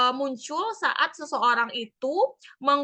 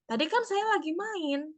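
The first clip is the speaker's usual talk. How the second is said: put-on lightly raised child's voice, whiny; a hint of a sulky child; child complaining; 6 semitones higher than usual. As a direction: play the kid grumbling she was busy playing. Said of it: "Misalnya ada anak yang curhat".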